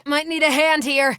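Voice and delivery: strained call for backup. Acted. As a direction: ask for help mid-fight. Struggling in combat.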